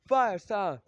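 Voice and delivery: loud, high attack shout; battle call. Acted. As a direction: call out the technique like a fighter. Ninja calls out his attack.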